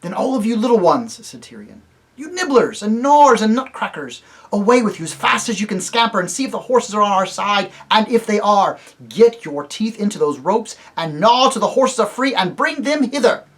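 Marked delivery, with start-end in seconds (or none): 0.0-1.2 higher, louder royal command; rally the little creatures like a king; a king sending out his small helpers. Acted. Tyrion the king giving orders. 2.2-13.4 higher, louder royal command; rally the little creatures like a king; a king sending out his small helpers. Acted. Tyrion the king giving orders.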